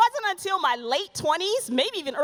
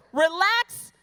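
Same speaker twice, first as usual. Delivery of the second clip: louder, higher relax; mock feminine relax; 3 semitones higher, 4 dB louder than usual. Shown as a demonstration. Women hearing relax, louder and higher. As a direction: bristle at the word relax. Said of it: "feminine interpretation".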